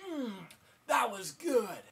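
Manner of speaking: lightly voiced greedy kid with a mouthful; greedy boy. Boy gobbling mom's chocolate bar, smacking mock-satisfied. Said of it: "shoves it all in his face and says".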